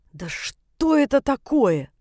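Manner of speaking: slow, exasperated sigh of frustration; exasperated outburst. A narrator's reading. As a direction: drag it out in annoyance. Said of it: "в сердцах выдохнула я". (в сердцах, с досадой)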